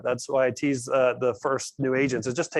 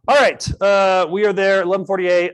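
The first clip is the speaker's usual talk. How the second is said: raised voice over the room; loud call to the room; 5 semitones higher, 6 dB louder than usual. Real, not acted. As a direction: call everyone back to attention. Calls the room to order.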